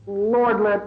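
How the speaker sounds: ordinary talk, nothing marked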